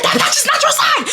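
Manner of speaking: very high, squealed mock yell; shrieky, insistent comeback; retold screaming. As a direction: screech it like a neighbor argument. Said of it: "we're screaming at each other".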